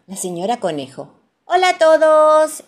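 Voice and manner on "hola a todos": put-on high, very loud child's greeting; excited high-pitched yell; shouting. A narrator's reading. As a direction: shout hello to the whole group. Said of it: "grita Rebeca".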